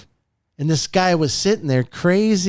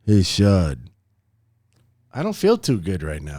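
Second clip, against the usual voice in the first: much lower, dropped and sagging; down and unwell; 9 semitones lower than usual. Shown as a demonstration. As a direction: sound like you feel lousy. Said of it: "the inflection is everything".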